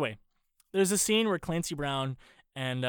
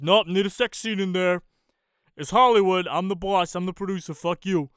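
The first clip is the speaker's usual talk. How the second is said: louder, dismissive mock studio boss; pushy producer; 2 semitones higher, 7 dB louder than usual. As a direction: overrule the writer with bluster. Acting out pushy producer overruling writer.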